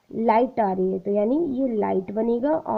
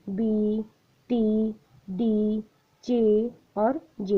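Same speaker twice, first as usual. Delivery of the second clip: slow listing; much slower than usual. Shown as a demonstration. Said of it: "कौन कौन से six consonants".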